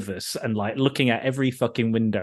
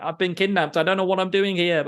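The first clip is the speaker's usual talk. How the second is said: mock Valentina; 6 semitones higher than usual. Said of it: "If she was like".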